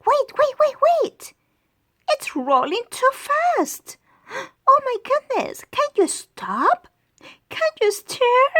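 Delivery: high, loud panicky little monkey; panicked cry. Acted. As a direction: yell in a rush as the car rolls away. Car rolling, monkey panics.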